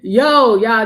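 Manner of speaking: grab the listeners' attention; calling out. Real, not acted. To the listeners about a funny moment, loud call.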